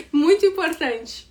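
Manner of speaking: usual speaking voice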